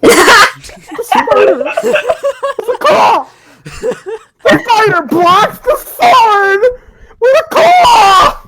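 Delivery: slow, high, loud mock-epic reading; milk the silly claw line for laughs; hammed-up shouting. Narrator mocking the spider's claw to friends, hammed-up slow shouting.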